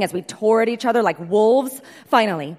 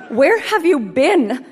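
high, slow, cracking voice; 6 semitones higher, 5 dB louder, slower than usual. Retold or imitated. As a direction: let the voice crack with hurt. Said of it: "His voice cracked".

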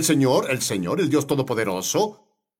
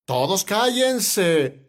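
high call for attention, then drawn out; slow, emphatic order for silence; acted command to hush; 5 semitones higher, much slower than usual. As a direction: act out a leader silencing a crowd. Moses hushes the crying people.

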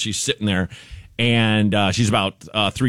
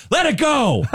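mock toddler yell. Bored toddler heckling the singer, high mock yell. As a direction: demand the song like a toddler. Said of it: "Like, just yells".

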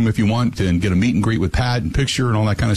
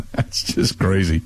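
laughing; 6 semitones lower, slower than usual. Real, not acted. Laughing at own shirt.

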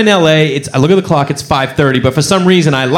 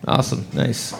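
ordinary talk

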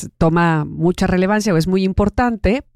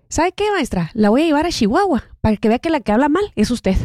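higher, laughing defiant comeback; defiant retort to teacher; 7 semitones higher than usual. Retold or imitated. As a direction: throw it back at the teacher with a laugh. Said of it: "se levantó y le dijo".

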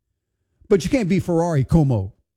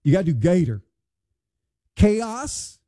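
Southern accent; 3 semitones higher than usual. Shown as a demonstration. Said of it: "you gotta do it with the accent".

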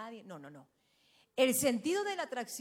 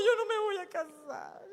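put-on high tearful child's voice, stressing yo; a little girl sobbing that she won't marry, stress on yo; imitating a crying child; 7 semitones higher, 4 dB louder than usual. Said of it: "Y se atacaba a llorar".

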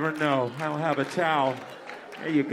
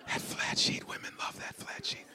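hushed whisper of a secret; 9 dB quieter than usual. Real, not acted. Secretive aside, unvoiced.

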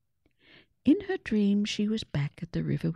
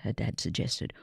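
ordinary delivery

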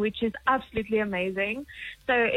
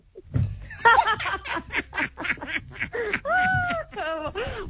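long, high-pitched burst of laughter; big laughing fit; 11 semitones higher than usual. Real, not acted. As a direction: crack up helplessly at a silly joke. Caller cracks up at the joke.

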